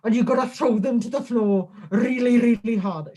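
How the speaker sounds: mock-sweet coaching in a Welsh lilt; [name]. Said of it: "I don't know why I put that accent on".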